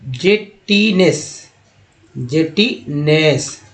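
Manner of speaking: जेटीनेस said very slowly, twice; जेटीनेस drilled slowly; slow model word. Shown as a demonstration. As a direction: stretch जेटीनेस for learners. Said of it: "फिर देखिए".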